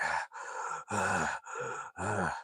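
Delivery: soft, spaced-out hard breaths; heavy breathing. Retold or imitated. GPS voice on the screen breathing really hard, heavy breaths. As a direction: imitate a machine panting between rants. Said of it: "breathing really hard".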